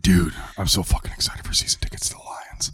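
excited whisper. Real, not acted. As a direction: hush it like a secret thrill. Hushed excitement about tickets.

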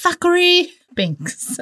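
high, slow, drawn-out "Thackeray Binks" in a put-on accent; posh accent. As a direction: roll out "Thackeray" grandly then drop into "Binks". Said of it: "I just want to say it with that kind of accent".

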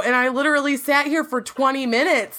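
ordinary talk, nothing marked

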